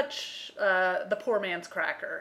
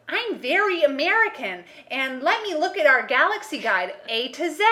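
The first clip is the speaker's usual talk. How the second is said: higher, louder, hammed-up fake American accent; bad American accent; 6 semitones higher, 5 dB louder than usual. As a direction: parody an actress faking American badly. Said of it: "she's talking".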